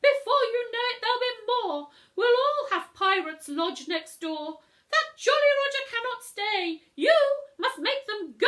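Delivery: high, loud fussy neighbour complaining. Acted. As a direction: whine it out like an outraged neighbour.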